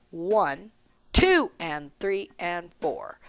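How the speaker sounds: slow low count, "two" standing out, "and four" falling away; accent on two. Shown as a demonstration. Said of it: "we have an accent on two".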